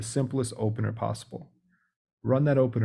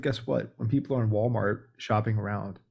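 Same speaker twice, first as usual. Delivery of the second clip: ordinary delivery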